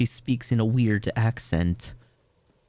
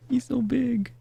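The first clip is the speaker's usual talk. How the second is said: high giddy squeal; delighted high-pitched gush; slower than usual. Real, not acted. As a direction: gush over him like a fan. Fangirling over game character.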